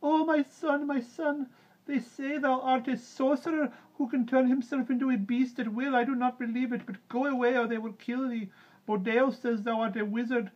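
very high, loud woman's voice; high falsetto motherly wail; crying-out mother. A narrator's reading. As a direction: plead urgently with a son. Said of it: "and cried".

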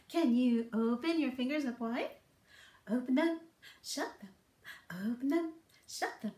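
usual speaking voice

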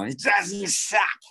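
slow, high, drawn-out character-actor line. Retold or imitated. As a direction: stretch it like an old character actor. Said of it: "a character actor named [name]".

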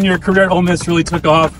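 ordinary delivery

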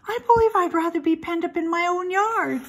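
lightly voiced piglet thinking aloud; small, high voice of a young pig; young pig's worried thought. A narrator's reading. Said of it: "he thought".

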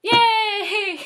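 a high, loud little whoop; excited cheer. Real, not acted. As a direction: pop off with delight. Cheer closing song intro.

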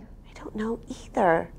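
hushed whisper; playful stage whisper. Acted. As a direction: breathe it out in a secret whisper. Whispered aside to the kids.

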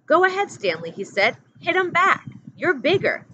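egging him on, voice rising high and loud; a counselor goading Stanley into fighting, eager and loud. A narrator's reading. Pendanski urging a fight.

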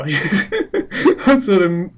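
chuckling through the words; laughing. Real, not acted. Retelling the guard scene to viewers while laughing.

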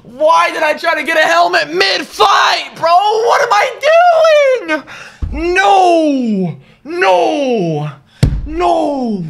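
high, loud frustrated wailing; anguished yelling. Real, not acted. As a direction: cry out in real gamer despair. Raging after dying mid-fight.

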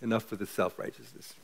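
usual speaking voice

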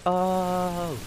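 a high, bright "Oh!" of pretend awe; mock gasp of amazement. Imagining friends reacting to a clip, mock amazed "Oh!". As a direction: play up the wow moment. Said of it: "we can all be like".

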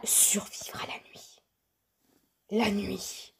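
slow, whispery drawn-out title; mock ominous announcement. Player announcing the game's survival goal, hushed mock-ominous. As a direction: intone it slowly in a hushed mock-spooky whisper. Puts on spooky game title voice.

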